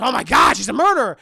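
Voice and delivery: shocked outburst. Retold or imitated. Daniel seeing the pictures, shocked and loud. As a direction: blurt out the shock.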